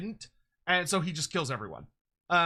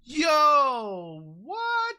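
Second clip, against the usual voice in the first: high amazed yelp; surprised; 7 semitones higher, 6 dB louder than usual. Real, not acted. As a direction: blurt out in amazement. Sees new search feature.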